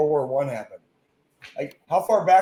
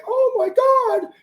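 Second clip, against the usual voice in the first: mocking panicky person; 7 dB louder than usual. Mocking scared people on a podcast, high whiny panic. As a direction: mimic a panicking whiner, way up high.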